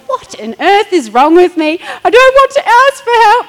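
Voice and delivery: high, loud, tearful wail of frustration; mock-distraught self-pity; upset retold cry. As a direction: replay a teary outburst, half laughing at yourself. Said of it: "I'm crying into the washing".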